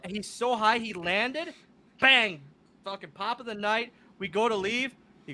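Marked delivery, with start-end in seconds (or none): none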